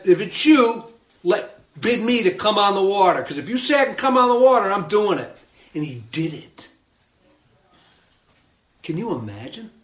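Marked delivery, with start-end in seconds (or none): 0.0-5.4 raised, louder, insistent plea; eager Peter. Acted. Preacher acting out Peter on the boat, eager and bold. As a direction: call out boldly over the waves.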